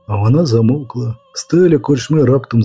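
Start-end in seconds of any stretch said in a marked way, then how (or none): none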